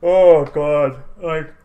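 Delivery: talking through a big yawn. Real, not acted. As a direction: yawn out the words, sleepy. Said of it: "Sorry, guys, I'm yawning so much".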